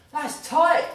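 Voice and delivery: loud, high imitated kid's cry; kid's outburst. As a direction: blurt it out like an indignant child.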